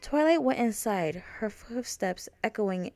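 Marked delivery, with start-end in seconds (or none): none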